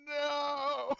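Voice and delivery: high, loud wail of no from a tower; mock dramatic yell. GM voicing Martin on a dramatic tower, loud mock cry. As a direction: howl it like a melodramatic villain. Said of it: "tower that you can stand on".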